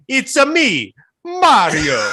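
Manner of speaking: Mario impression. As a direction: tease the accent as a video game plumber. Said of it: "he was about to be like".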